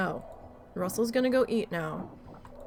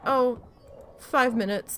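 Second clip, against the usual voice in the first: higher and louder, airy dismissal; mock carefree in-law; breezy mock; 4 semitones higher, 4 dB louder than usual. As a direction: toss it off like it's nothing. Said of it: "they're like".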